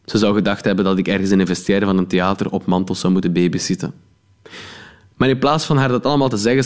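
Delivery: ordinary delivery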